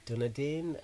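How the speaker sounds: "Dunedin" with an oo in the first syllable; alternative pronunciation. Shown as a demonstration. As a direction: say "Dunedin" as DOO-nuh-din. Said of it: "What do they call it?".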